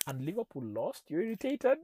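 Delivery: retold disbelief, leaping up high and loud at the end; incredulous question. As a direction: question it as if it makes no sense. Acting his incredulous reply to wife.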